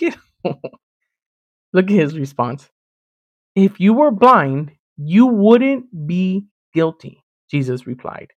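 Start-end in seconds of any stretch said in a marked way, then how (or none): 3.6-7.2 very slow, deliberate reading with spaced-out words; slow, pointed. Acted. Preacher voicing Jesus's reply to the Pharisees, slow and pointed. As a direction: draw out each word of the verdict.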